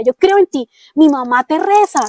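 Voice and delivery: pleading prayer. Retold or imitated. Praying to the Lord in tears.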